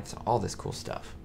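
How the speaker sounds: usual speaking voice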